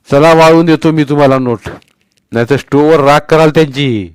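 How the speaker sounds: put-on old man offering. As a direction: gruffly offer to help. Old man's voice.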